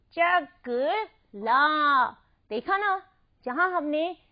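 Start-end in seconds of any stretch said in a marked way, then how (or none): 0.1-2.1 चकला in three slow syllables, final ला held; stressed long आ. Shown as a demonstration. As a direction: break up चकला and lean on ला. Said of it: "हमने इसको जोर से उच्चारित किया".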